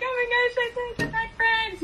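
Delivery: high mock-gushing quote; imitated excitement. Teasing the friend about what she said, mock excited. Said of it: "when you're like".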